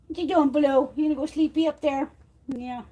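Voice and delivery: cooing at her cat; pet talk. Real, not acted. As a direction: coo at the cat lovingly.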